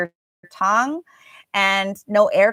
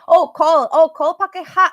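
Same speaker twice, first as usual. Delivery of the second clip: mock Indonesian accent; 7 semitones higher, 6 dB louder than usual. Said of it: "you need to learn how to say that".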